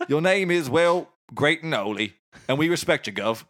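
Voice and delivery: matey Cockney mock prayer; Cockney accent. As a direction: recite a prayer as a chummy Cockney. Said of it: "in Cockney".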